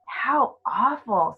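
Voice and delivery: Lucy gasps it out; shocked girl. Acted. Lucy reacts to the fawn in a shocked girl's voice. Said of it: "said Lucy".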